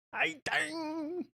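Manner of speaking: slow, high, soft New York greeting; hammed-up New York accent. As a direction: croon the New York catchphrase. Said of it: "a whole nother accent".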